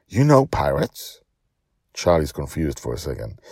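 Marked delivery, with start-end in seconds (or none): none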